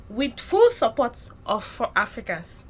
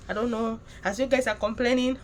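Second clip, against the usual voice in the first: ordinary delivery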